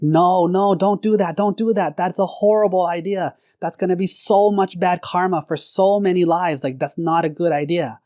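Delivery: high-pitched urgent pleading from the wife; alarmed protest. Acted. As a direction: plead hard against a terrible plan. Wife pleading against torture.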